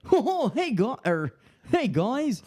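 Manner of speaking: high Goofy "hey, guys"; Goofy impression. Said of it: "Goofy's just like".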